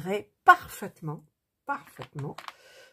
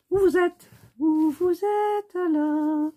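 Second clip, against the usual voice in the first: much higher, drawn-out slow call; sing-song calling; 12 semitones higher, 5 dB louder, slower than usual. Real, not acted. Crafter searching for her threads, high slow sing-song call. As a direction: croon it like calling to a hidden pet.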